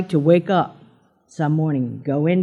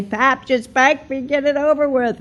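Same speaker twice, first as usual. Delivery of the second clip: put-on childish plea; 10 semitones higher than usual. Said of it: "I want to say".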